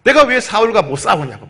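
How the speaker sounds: put-on indignant protest, pitched high; mock temper, high insistent voice; feigned anger. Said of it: "성질을 내는 척 하는 거예요".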